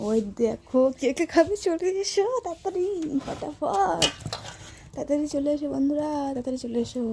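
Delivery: high excited call. Real, not acted. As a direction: call everyone over eagerly. Calling friends to come eat.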